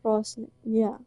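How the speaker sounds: ordinary talk, nothing marked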